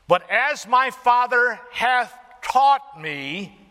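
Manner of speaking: loud high preaching that slows and swells; full-force preaching. Real, not acted. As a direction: build it up to a ringing climax.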